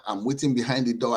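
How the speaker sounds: ordinary talk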